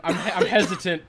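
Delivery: normal talking voice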